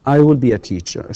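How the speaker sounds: I will be a teacher with will stressed, falling at the end; clear model sentence. Shown as a demonstration. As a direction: model read with will leaned on. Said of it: "model sentence demo".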